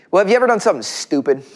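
ordinary delivery

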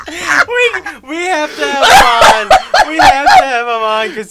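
loud, high, slow excited plea; hyped about bringing him on; excited insistence. Real, not acted. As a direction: push hard, thrilled by the idea.

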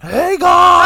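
piercing high imitation yell; mimicked greeting shout. Friend recalling where their mind went, high mimicking shout. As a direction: scream the greeting like a crowd vendor. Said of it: "when you went".

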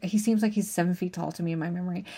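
usual speaking voice

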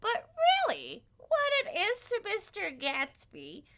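very high, hammed-up teacher voice; English teacher impression. Teacher explains Gatsby's green light, high mock-teacher voice. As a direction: lecture with exaggerated literary fervor. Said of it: "This is indeed my impression of my, um, eleventh grade English teacher".

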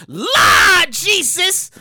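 loud, slow drawn-out cry to the Lord; slow, loud holler of Lord Jesus; exclaiming. Real, not acted. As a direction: call out to heaven in shock. Exclamation at sentence.

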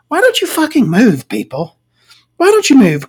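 high-pitched exasperated plea to the townsfolk; mock exasperated yell. Viewer yelling at the townsfolk on screen, high and exasperated. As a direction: whine it way up, begging them to just leave. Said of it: "and you just go".

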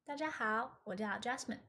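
ordinary talk, nothing marked

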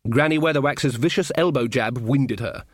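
ordinary delivery, nothing marked

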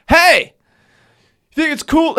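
high, loud challenge; hammed-up indignant holler; mock confrontation. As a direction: call her out at the top of your range. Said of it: "I remember I was just like".

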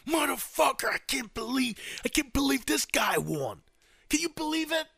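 high-pitched outraged disbelief; outgoing president fuming. Acted. Outgoing president venting privately before the concession call, high outraged rant. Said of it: "probably punching there like".

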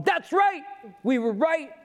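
high, exultant self-righteous crowing; mock gloating. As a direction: crow in hammed-up triumph.

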